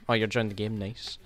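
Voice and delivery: ordinary delivery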